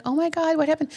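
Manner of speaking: high alarmed oh my God. Retold or imitated. Mom asking her crying daughter, alarmed and high. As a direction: rush in worried and high.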